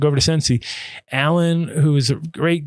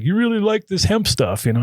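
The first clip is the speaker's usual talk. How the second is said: raised higher, easygoing question; Hank's voice; 10 semitones higher than usual. Retold or imitated. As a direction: imitate the shop owner lightly. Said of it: "Hank, was like".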